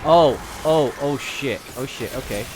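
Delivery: ordinary talk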